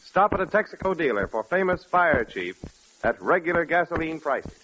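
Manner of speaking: ordinary talk, nothing marked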